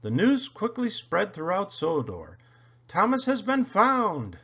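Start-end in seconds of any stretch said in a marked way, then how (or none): 2.9-4.4 high, loud joyful call; excited cheer. A narrator's reading. Engines spreading the news, excited high call. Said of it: "the engines whistled".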